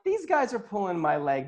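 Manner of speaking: high-pitched, mock-incredulous voice; retold disbelief. Healer sure they were joking with him, incredulous. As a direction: act out not buying it, pitched right up. Retells his disbelief at the praise.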